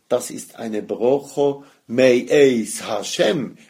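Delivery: slow, weighty stress on „me'eis Hashem“. Shown as a demonstration. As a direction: lean into „me'eis“ slowly and deliberately. (langsame Betonung auf „me'eis Hashem“)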